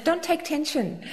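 slow, high, playful take on the phrase; mimicking Mumbai slang. Host quoting the bar women's favourite phrase, lightly mimicking. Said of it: "They use things, they say".

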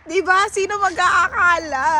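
choked up, near tears; teary. Real, not acted. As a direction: voice cracking with emotion. Repeats with emotion, cry heard. (naiiyak na boses)

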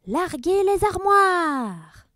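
high, slow, loud shouted order; bellowing captain. Acted. Captain roars an order to his crew. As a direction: bellow it through a megaphone. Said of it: "il hurla dans son porte-voix".

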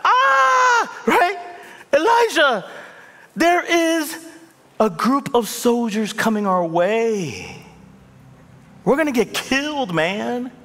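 mock-panicked servant shouting a warning. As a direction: cry out in terror of the army. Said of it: "the servant goes back".

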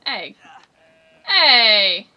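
sudden loud "hey"; calling out. Real, not acted. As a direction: holler to get attention. Calling out to game character.

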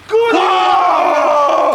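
slow-motion shout, stretched out; drawn-out shout in slow motion. Real, not acted. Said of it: "zwolnione tempo".

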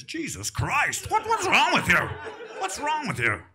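high, loud shocked father yelling at his wife; a startled father shouting what's wrong. Retold or imitated. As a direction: play the horrified dad who can't believe it. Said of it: "my father would react like this".

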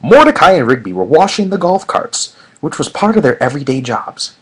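usual speaking voice

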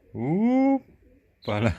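high, loud, long drawn-out hoppala; playful hoppala. Pretend. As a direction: stretch it out like lifting a baby. Lifting the baby animal up playfully.

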